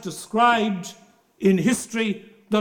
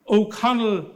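ordinary talk, nothing marked

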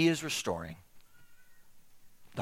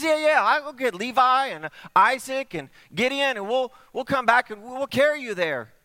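high, loud, bubbling friend; eager plan; 9 semitones higher, 7 dB louder than usual. Acted. Friend proposes carrying him.

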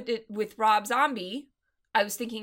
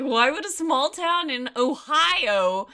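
higher, drawn-out disbelieving question; incredulous; 5 semitones higher, 5 dB louder, slower than usual. Retold or imitated. Podcaster questioning the small-town festival, incredulous. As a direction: stretch it out in disbelief. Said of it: "I found that really hard to believe".

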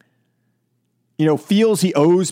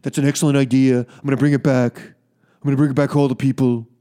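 lower, hammed-up Trump impression; mock Trump; 6 semitones lower than usual. Host mimicking Trump agreeing eagerly to Putin, low mock voice. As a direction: play the eager yes-man in a put-on Trump voice. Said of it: "Donald Trump's like".

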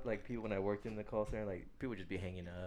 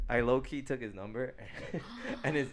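louder, higher, laughing through the words; laughing confession; 6 semitones higher, 8 dB louder than usual. Real, not acted. Admitting to a prank on a rude guy, laughing confession. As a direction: break into laughs as you admit it.

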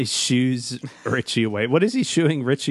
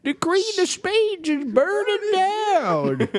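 high, slow cracked yell; old prospector; slower than usual. Retold or imitated. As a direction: squawk it like a frantic old miner. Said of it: "starts yelling".

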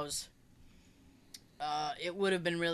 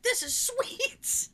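high, slow mock delight; much slower than usual. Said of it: "It's like".

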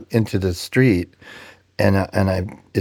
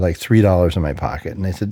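usual speaking voice

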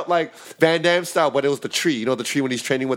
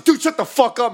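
higher, louder comeback; mock retort; 8 semitones higher, 4 dB louder than usual. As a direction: snap back at the preachy guy. Other hipster retorts.

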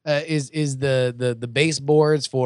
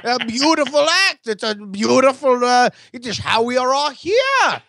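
put-on Norwegian accent; 7 semitones higher, slower than usual. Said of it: "you'd say it in your Norwegian accent".

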